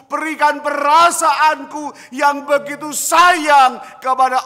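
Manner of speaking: loud, high, slow sermon climax; preaching at full force. Real, not acted. Pastor urging the congregation never to compromise, preaching loud and slow. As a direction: pour it out from the pulpit, stretching each phrase.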